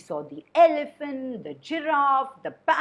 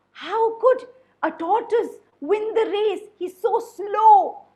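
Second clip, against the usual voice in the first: scoffing disbelief; 8 semitones higher, 7 dB louder than usual. Acted. Animals doubt the tortoise.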